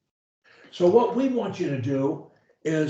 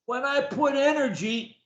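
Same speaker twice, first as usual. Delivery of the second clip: with energy; 6 semitones higher, 3 dB quieter than usual. Shown as a demonstration. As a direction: sell it with energy and conviction. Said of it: "the way I do, with energy".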